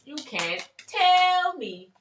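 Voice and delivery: slow, high, drawn-out praise; testifying. Retold or imitated. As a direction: preach it like a church moment. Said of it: "And I was like".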